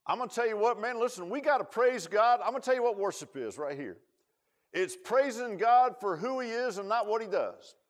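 high, loud preaching climax; full-force preaching. Acted. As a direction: proclaim it to the whole church.

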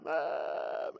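usual speaking voice

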